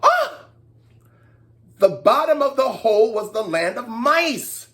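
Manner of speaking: ordinary delivery, nothing marked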